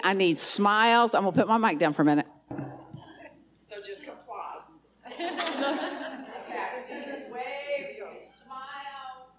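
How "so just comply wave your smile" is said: distant off-mic calling; calling out from across the room; far from the mic. Real, not acted. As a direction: voice heard away from the microphone.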